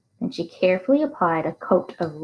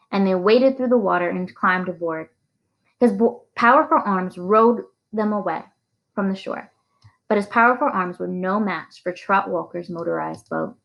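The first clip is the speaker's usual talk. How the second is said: ordinary delivery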